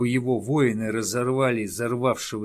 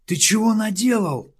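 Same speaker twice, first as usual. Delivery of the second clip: high, loud frightened yelp; 10 semitones higher, 6 dB louder than usual. Acted. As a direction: yelp it out in alarm. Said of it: "испугался Малеста".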